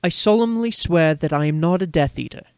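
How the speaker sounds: ordinary delivery, nothing marked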